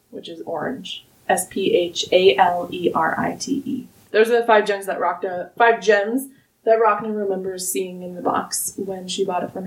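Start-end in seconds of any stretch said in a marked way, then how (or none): 1.3-3.9 S P H A L E R I T E letter by letter; spelled out. Shown as a demonstration. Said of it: "spells the word".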